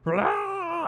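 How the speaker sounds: high drawn-out creature roar; imitated monster roar. Storyteller imitating the mutated woman attacking, high monster roar. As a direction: let out a comic monster screech.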